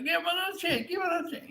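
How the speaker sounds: high, soft pleading imitation; imitated wife. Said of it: "you know, my wife".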